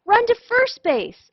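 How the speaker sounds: high, loud urgent yell. Acted. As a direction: urge her on from the bench. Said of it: "yelled the boys".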